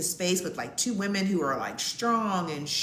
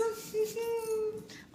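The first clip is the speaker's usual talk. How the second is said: high giggle; 6 dB quieter than usual. Real, not acted. Laughing about charismatic date.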